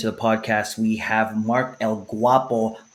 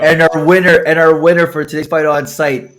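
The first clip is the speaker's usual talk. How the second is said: announcer voice; 4 semitones higher, 7 dB louder than usual. Acted. Announcing the fight winner.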